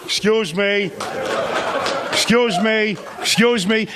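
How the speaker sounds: De Niro-like repeated tough guy; menacing father-in-law. Retold or imitated. Comic imitating his wife's scary father, De Niro tough guy. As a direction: lean in, say it like a threat. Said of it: "I got this".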